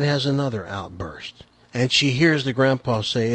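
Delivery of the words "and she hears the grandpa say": ordinary delivery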